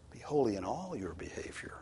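whispered. Acted. Preacher whispering the verse to listeners, hushed.

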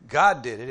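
GOD hit hard and loud; driven home. Shown as a demonstration. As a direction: hammer the word GOD home. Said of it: "the idea is driven home".

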